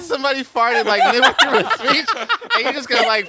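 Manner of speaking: high and loud, giggling through it; teasing a friend through laughter; laughing tease. Real, not acted. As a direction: crack up while accusing him. Teasing a friend about farting.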